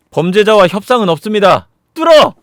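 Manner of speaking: firm line rising to a shouted order; acted military order, loud at the end; soldier's command. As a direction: declare it, then shout the command. Soldier refuses, orders the attack.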